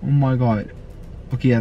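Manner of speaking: ordinary talk, nothing marked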